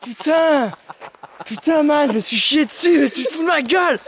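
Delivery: high, panicked shouting after a fright; scared yelling. Real, not acted. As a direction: yell in real fright. Ami lui fait peur, il crie. (cri de panique après une frayeur)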